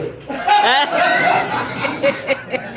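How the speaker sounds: breathy laugh at his punchline; own laugh. Real, not acted. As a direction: laugh at your own joke. Laughs after last joke.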